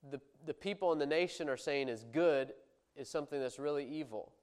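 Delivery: ordinary talk, nothing marked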